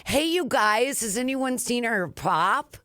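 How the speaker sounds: high, teasing call to the room; mocking coworkers. Coworkers mock the Minnesota word, calling out teasingly high. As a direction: tease her loudly to the whole office.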